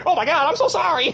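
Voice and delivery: high-pitched frantic apology; mock horrified apology. Man to the partner he hit, high horrified apology. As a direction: blurt out a panicked sorry.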